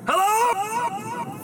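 high ringing hello; cave echo. Acted. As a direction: call it out into an echoing cave. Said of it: "it's like an echo inside here".

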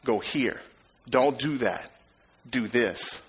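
quietly steer them, hushed; soft voice. Acted. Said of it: "hear that soft voice say".

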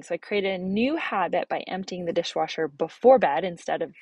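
normal talking voice

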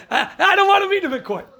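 high, laughing, stuttering plea; mock frightened stammer. Judge scared of meeting the killer in court, mock panicked. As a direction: act out a terrified judge, half laughing.

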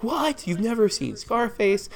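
high, squeaky disbelieving gasp; incredulous shock. Retold or imitated. Friend mocking a Scarface newbie, shocked and high. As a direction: play the shocked friend who can't believe it. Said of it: "most people will be like".

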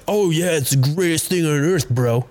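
a hint of a starry-eyed fan, mocked; mild mock enthusiasm for the cause; mocking liberal. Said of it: "liberals want to be like".

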